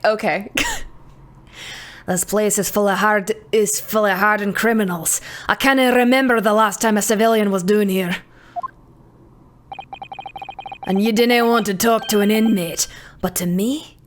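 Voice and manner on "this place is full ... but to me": put-on Scottish-accented prison governor. Prison governor receiving a visitor, Scottish accent. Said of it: "Do you know how to do a Scottish accent?".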